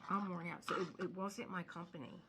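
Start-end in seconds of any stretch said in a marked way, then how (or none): 0.1-0.6 worn out. Retold or imitated. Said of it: "I was like".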